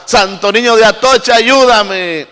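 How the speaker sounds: re-enacted plea to a saint, pitched high; imploring prayer. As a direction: call out high, imitating a desperate supplicant. Re-enacts past pleas to saints. (súplica recreada a los santos, en tono alto)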